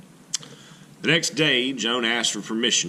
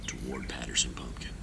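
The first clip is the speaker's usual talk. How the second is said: whispered, much softer; hushed narration; 8 semitones lower, 10 dB quieter, faster than usual. A narrator's reading. Narrator whispers as the ghosts creep, hushed. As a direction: breathe it like a secret. Sneaking before sunrise.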